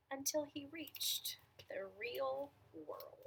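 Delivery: sing-song storytelling with swooping pitch; picture-book narration. A narrator's reading. Reader telling children about the strange real world, storytelling. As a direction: read each sentence as its own little picture.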